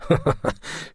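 short, soft snigger; sneering chuckle. A narrator's reading. The man scoffs at Naya's question, sniggering. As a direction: snigger derisively. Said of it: "L'homme ricana".

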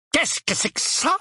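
high, hoarse alarm. A narrator's reading. Uncle Vernon asking about the letter, hoarse voice. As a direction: croak the question out, pitched high. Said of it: "d'une voix rauque".

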